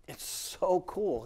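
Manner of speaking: normal talking voice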